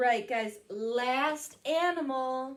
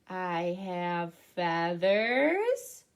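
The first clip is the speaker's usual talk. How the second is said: lightly voiced owl; 6 semitones lower, much slower than usual. Owl speaks in the guessing game.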